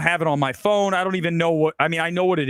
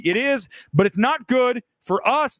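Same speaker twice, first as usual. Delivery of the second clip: ordinary talk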